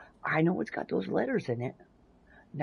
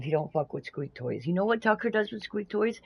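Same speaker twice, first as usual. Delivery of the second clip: usual speaking voice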